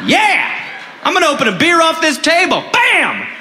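party hard and yell; rowdy shout. Acted. Rowdy brother crashing in.

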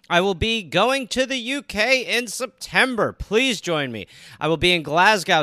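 upbeat promo read of tour dates. Acted. Comedian reading his tour dates to listeners, promo read. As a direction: sell the shows, bright and steady.

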